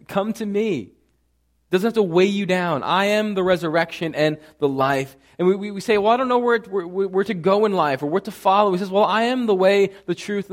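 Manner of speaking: loud, rising sermon climax, each claim hammered; preaching at full force. Acted. Pastor proclaiming Christ's I am sayings to the church, preaching at full force. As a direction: preach it out to the whole congregation.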